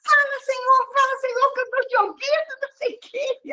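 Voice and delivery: very high, put-on praying voice, fading at the end; mock fervent prayer. The girl imitated praying to the Lord, high and tearful. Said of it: "aí ela".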